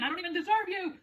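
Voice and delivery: very high, put-on little voice; toy's voice. Speaking as the toy, high put-on voice. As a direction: squeal it as a tiny character.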